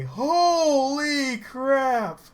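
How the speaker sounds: real stunned wow; amazed. Real, not acted. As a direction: stretch the words out in awe. Amazed that the stunt worked.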